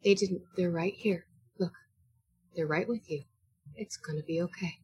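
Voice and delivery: whisper-soft comfort to a grieving man; soft reassurance. Acted. Comforting Skip about brothers.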